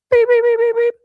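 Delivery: high, loud rapid beeps; imitated alarm. Host reminded of the flood, piercing imitated alarm beeps. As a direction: mimic the shrill alarm on one pitch. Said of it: "I'm reminded of it commonly".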